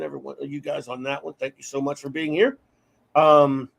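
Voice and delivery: normal talking voice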